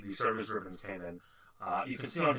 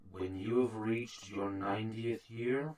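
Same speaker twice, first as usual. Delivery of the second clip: put-on slow, soft old man's rebuke; measured, hushed reproach from an aged officer; elderly interrogator; 3 semitones lower, 6 dB quieter than usual. Old interrogator answers Aiden's jibe about walking, as a slow elderly man. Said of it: "elderly man".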